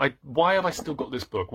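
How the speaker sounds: ordinary talk, nothing marked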